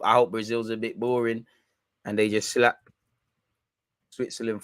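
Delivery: ordinary delivery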